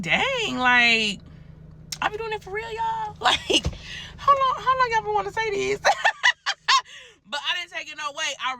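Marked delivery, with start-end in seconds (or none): 0.0-5.8 high, squeaky mock shock with a laugh; surprised disbelief. Realizing friends agreed about her. 5.9-7.0 high-pitched burst of laughter. Real, not acted. Laughing after her own question.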